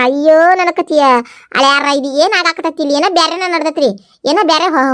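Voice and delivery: ordinary talk, nothing marked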